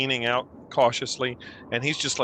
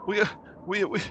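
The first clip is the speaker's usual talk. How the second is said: high, halting, fearful voice; distraught survivor; 12 semitones higher than usual. Acted. Villager telling the party who was killed last night, distraught. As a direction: recount the horror haltingly.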